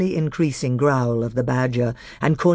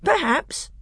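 cold. Acted. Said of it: "in a cold voice".

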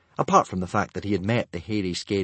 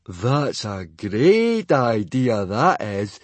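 very slow, dry sarcasm, louder; sarcastic; 6 dB louder, much slower than usual. A narrator's reading. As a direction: drag it out sarcastically. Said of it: "said Fishlegs sarcastically".

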